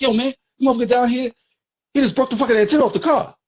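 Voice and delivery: high, fast, worked up; agitated. Retold or imitated. V on the phone to the narrator, fast and outraged. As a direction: rush it out, outraged.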